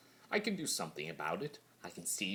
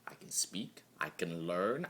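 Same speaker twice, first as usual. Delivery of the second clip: ordinary delivery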